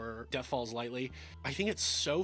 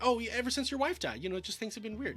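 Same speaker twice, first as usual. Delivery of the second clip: high, halting excuse; imitated nervous stammer; 7 semitones higher, faster than usual. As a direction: play a maid fumbling for a reason. Maid stammering an excuse to Oliver.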